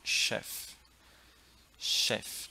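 "Chef" with a long "sh" opening. Shown as a demonstration. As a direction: hush into "Chef" as "shef". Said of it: "é o som dela". ("Chef" com som de X de xícara)

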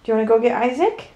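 normal talking voice

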